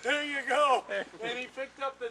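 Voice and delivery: pleased laughing affirmation. Real, not acted. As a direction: laugh it out in agreement. Laughing agreement.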